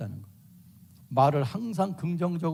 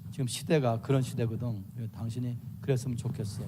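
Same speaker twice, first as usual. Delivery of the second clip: lower, softer calm voice; a wife calmly raising a complaint, lower and softer; 6 semitones lower, 5 dB quieter than usual. Acted. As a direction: ask your husband gently, without heat. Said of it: "이렇게 말로".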